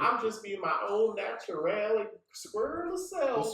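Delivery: lightly Southern squirrel voice; Southern accent. Retold or imitated. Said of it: "Southern accent on ten".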